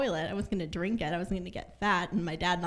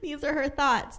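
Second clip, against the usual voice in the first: worried mom; 8 semitones higher, 5 dB louder than usual. Retold or imitated. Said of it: "my mom ... was like".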